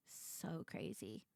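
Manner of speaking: whispered aside. Real, not acted. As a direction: breathe it under your breath.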